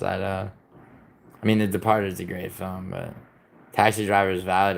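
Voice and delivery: ordinary talk, nothing marked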